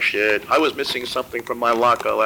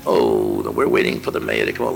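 mock news reporter, much lower voice; radio reporter; 12 semitones lower than usual. Buddy mimicking the radio reporter waiting outside, low mock anchor voice. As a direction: drop the pitch way down like a live radio anchor. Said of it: "I remember listening on the radio".

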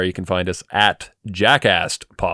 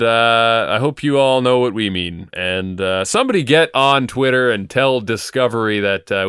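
normal talking voice

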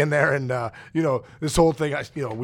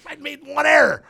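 higher, loud indignant shout; retold outburst, higher and louder; indignant protest; 10 semitones higher, 6 dB louder than usual. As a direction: shout it like an outraged player defending himself. Player protests arbitration critique.

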